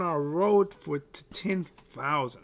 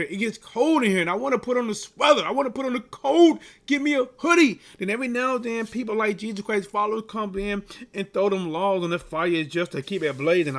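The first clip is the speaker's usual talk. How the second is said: ordinary talk, nothing marked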